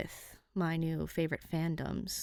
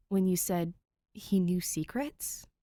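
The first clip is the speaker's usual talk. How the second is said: whispering. Acted. Whispered question.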